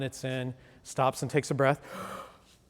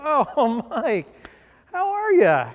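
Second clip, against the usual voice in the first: mock cheerful; 10 semitones higher, 9 dB louder, much slower than usual. Mock-friendly greeting to the salesman.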